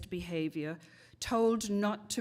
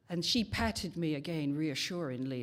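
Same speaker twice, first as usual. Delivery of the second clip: usual speaking voice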